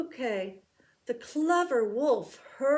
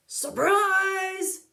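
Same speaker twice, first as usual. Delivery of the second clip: high, loud gleeful shout; spring the surprise with a cry; wolf's cry; 8 semitones higher, 5 dB louder than usual. Acted. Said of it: "cried the wolf".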